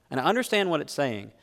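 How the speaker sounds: normal talking voice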